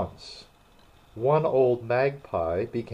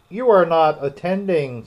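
louder, higher scolding; severe; 6 semitones higher, 6 dB louder than usual. A narrator's reading. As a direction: scold like a stern schoolteacher. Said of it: "said the mouse to Alice severely".

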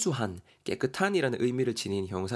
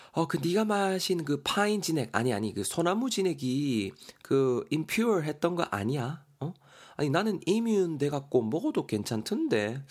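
teasing friend; 6 semitones higher than usual. Acted. Character 현실이 quoted line.

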